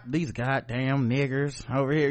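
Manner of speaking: lightly voiced Southern bigot.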